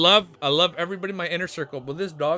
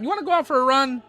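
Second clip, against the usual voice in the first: higher, quick excited puppy talk; 8 semitones higher, 7 dB louder, faster than usual. Real, not acted. Owner asking his puppy about a run, quick baby talk. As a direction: hype the dog up for a walk.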